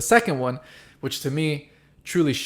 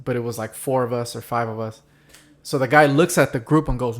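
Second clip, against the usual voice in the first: ordinary talk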